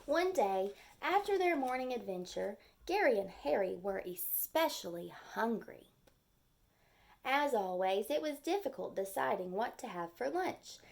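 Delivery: gentle storybook read-aloud; lively story narration for young kids; reading to children. A narrator's reading. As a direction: read it like a picture book for the class. Teacher reads picture book aloud.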